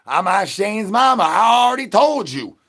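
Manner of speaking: high, loud protest; indignant shout. Acted. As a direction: yell it, fed up. Denying being Shane's mama.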